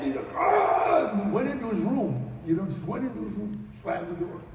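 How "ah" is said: loud, high burst of rage; acted angry roar; mad yell. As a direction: let out a furious, high yell. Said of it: "then he got so mad".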